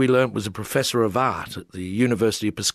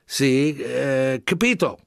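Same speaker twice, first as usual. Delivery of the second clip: Italian agreeing; 4 semitones higher, slower than usual. A narrator's reading. Gianni voiced agreeing.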